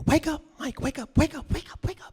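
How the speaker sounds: high, loud, rapid wake-up pleas; hammed-up frantic wake-up call, pitched high; urgent waking. [name] to sleeping Mike at dawn, urgent. [name] shaking him awake in bed.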